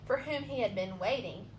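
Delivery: usual speaking voice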